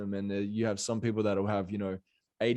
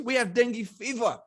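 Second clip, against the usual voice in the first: pitched way up, mimicking the Balinese lady's reply; imitating a local lady; 12 semitones higher, 3 dB louder than usual. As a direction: play the local woman brushing off covid, high and light. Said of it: "she goes".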